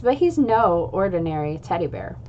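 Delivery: usual speaking voice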